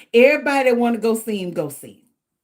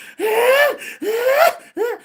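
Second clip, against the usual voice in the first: laughing gasp. Real, not acted. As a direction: gasp as the laugh takes over. Gasp while laughing and crying.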